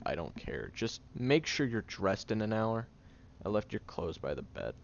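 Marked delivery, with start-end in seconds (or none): none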